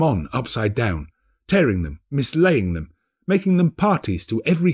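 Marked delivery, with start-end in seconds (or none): none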